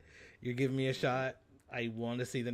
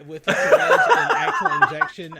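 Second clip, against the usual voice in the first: words squeaked out high and loud; squealing high; 16 dB louder, slower than usual. Real, not acted. To her cohost, squeaking high and loud mid-laugh. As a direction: push the pitch way up, loud and slow.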